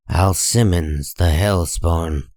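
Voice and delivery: ordinary talk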